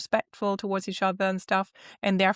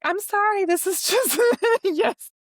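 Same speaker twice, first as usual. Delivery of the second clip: high, loud imitation apologizing, breaking into laughter; retold "sorry" pitched way up, laughing through it; mock apology; 12 semitones higher, 4 dB louder than usual. Co-host imitating the contestant's failed apology, high mock-apologetic. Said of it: "she was like, trying to apologize".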